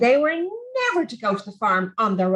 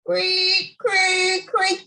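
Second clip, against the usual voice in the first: creak sound; 6 semitones higher, much slower than usual. A narrator's reading.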